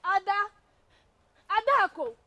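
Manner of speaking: high, forceful shout; calling out. Acted. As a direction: summon someone urgently from a distance. Calling out to Ada.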